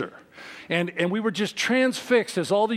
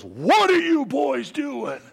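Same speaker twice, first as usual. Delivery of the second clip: stern demand; 7 semitones higher, slower than usual. Retold or imitated. Man in suit confronting boys.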